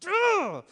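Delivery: high, loud frustrated groan; big exasperated ugh. Retold or imitated. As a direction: groan out your annoyance. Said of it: "I was".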